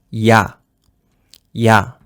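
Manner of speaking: "ya" as one syllable, I and A almost at once; almost simultaneous vowels. Shown as a demonstration. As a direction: say "ya" low and short, no gap between the vowels. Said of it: "presque en même temps".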